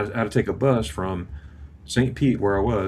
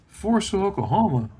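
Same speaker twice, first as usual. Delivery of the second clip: imitating a dad's disbelief, pitched high at first; incredulous echo; 11 semitones higher than usual. Dad reacting to his son's Army posting, incredulous. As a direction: repeat it back as a disbelieving question.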